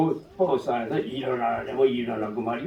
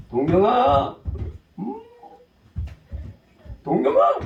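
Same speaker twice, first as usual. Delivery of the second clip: a louder call that climbs higher; 12 semitones higher, 5 dB louder than usual. Retold or imitated. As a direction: yell his name again, getting impatient. Brother calling, no answer. (대답 없는 동생을 부르는 형의 외침)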